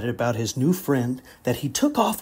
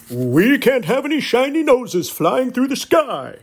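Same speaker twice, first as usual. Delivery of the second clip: higher, louder firm Santa; stern Santa; 8 semitones higher, 4 dB louder than usual. Acted. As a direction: lay down the rule as Santa. Santa forbids shiny noses.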